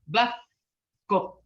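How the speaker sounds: Busco with the tongue behind the bottom front teeth, C barely heard; Busco demo. Shown as a demonstration. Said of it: "your tongue is on the bottom of your front teeth".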